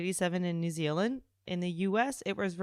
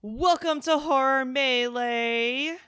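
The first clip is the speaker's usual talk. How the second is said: slow, drawn-out show intro; announcer voice; 6 semitones higher, 4 dB louder than usual. Acted. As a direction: welcome them like a host. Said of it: "host intro announcement".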